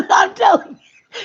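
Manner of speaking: loud and high through laughter; laughing as she calls out to her family, loud and high; laughing shout. Real, not acted. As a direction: crack up while calling it out.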